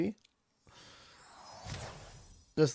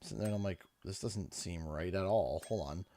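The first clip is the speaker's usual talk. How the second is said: muttering to self; 5 semitones lower, 10 dB quieter than usual. Real, not acted. Muttering at game glitch, to self.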